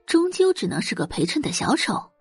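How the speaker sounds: plain reading voice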